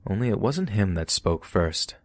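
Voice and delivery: plain reading voice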